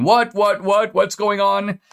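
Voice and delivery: high, slow mock-confused old politician; a confused, dazed elderly president asking what is happening; Joe Biden impression. Host mocking Garland as a bewildered president, high and slow. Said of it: "Garland is kind of playing Joe Biden".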